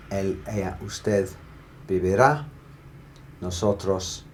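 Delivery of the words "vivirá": vivirá with emphasis on rá; vivirá, rising onto a strong rá; final-syllable stress. Shown as a demonstration. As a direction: punch the last syllable of vivirá. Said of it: "stressing that very last syllable".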